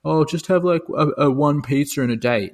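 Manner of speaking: high, mocking voice; mocking quote. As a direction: send up dumb advice. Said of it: "than it is if you're eating".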